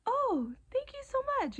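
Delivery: high, sweet retold thank-you; gushing thanks. Thanking a little girl for her note, high and gushing. Replying to a little girl's gift.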